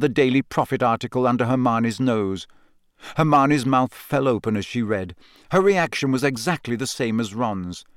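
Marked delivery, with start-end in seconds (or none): none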